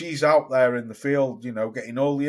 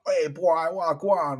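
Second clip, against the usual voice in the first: mock Jamaican patois; 2 semitones higher than usual. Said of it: "the London accent in her patois".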